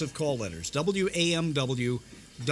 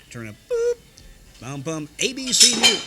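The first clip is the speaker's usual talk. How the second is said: much louder, higher imitated network chime; network jingle; 4 semitones higher, 7 dB louder than usual. Station hits the network on the hour with the chime, loud. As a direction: belt out the network sounder. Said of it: "network joining on the hour".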